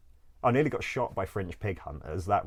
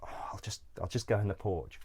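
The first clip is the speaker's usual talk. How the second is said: softer, half-whispered sneaking words; sneaking into a church porch, hushed; hushed self-quote; 6 dB quieter than usual. Retold or imitated. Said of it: "and was like".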